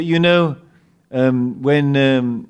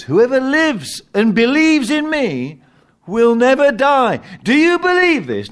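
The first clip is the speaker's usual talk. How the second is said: preaching at full force; 10 semitones higher, 5 dB louder than usual. Acted. Preacher quoting Jesus to the congregation, ringing. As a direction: ring it out to the hall.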